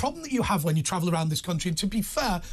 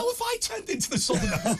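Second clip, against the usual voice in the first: high, laughing disbelief; 14 semitones higher than usual. Real, not acted. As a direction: laugh through the question. Laughing at being called southerner.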